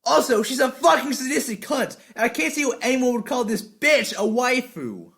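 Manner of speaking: animated reviewer delivery; energetic voice. Shown as a demonstration. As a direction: sell the insult with energy. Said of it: "talk in a really energetic voice".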